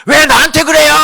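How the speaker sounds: high-pitched, defensive shouted protest; sudden touchy outburst, high; indignant shout. Acted. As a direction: yell back as if unfairly picked on. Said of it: "이렇게 소리를 질러요".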